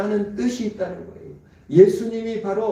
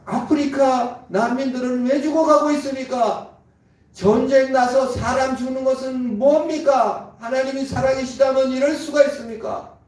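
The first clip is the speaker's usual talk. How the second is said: higher, louder protesting questions; indignant doubter; 7 semitones higher, 5 dB louder than usual. Retold or imitated. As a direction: demand answers from God, indignant. Voicing doubters questioning God. (항의하듯 높은 목소리로)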